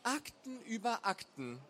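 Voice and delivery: slow, high, weary moan; groaning complaint. A narrator's reading. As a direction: groan it out, dragging the words. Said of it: "Stöhnend wandte sie sich".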